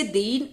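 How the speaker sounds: दीन with the ee held long; drawn-out vowel. Shown as a demonstration. Teacher contrasting long with short matra, दीन held long. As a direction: stretch the middle of दीन. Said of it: "खींचकर बोलेंगे".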